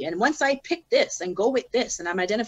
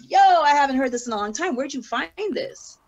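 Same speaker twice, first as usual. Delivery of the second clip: higher, loud "Yo" easing into a question; surprised; 6 semitones higher, 4 dB louder than usual. Retold or imitated. Rapper hearing her old song in the truck, surprised. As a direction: burst out in delighted recognition.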